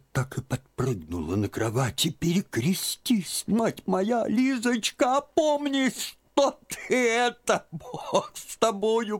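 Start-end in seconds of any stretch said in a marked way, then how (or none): 2.1-9.2 put-on very high, slow stammering old woman; shocked and fumbling for words; babbling old aunt. A narrator's reading. Aunt stammering at Liza's wish for the convent. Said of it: "пролепетала она наконец".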